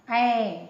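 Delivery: ऐ as a big, open, held vowel; open-mouthed ऐ. Shown as a demonstration. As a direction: open the mouth wide and hold ऐ. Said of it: "मुंह खुलता है इसमें". (मुंह खोलकर लंबा ऐ)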